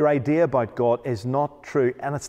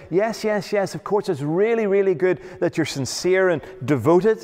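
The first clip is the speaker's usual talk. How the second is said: high, gushing mock reassurance; weasel-word flattery; 7 semitones higher than usual. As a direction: fawn over them like a smooth talker, pitched up. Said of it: "no weasel word saying".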